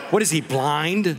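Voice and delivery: normal talking voice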